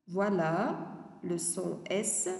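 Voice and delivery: normal talking voice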